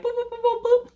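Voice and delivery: high robotic scanner boops; rapid high-pitched electronic beeps; meter beeping. Retold or imitated. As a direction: imitate a detector going off. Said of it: "my Caribbean meter was like".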